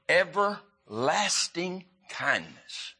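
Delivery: "everlasting" drawn out slowly, syllable by syllable; slow, weighted pauses through "everlasting"; stress on everlasting. Real, not acted. Preacher reads a promise to the congregation, "everlasting" slow and emphasized. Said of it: "want to emphasize that word everlasting".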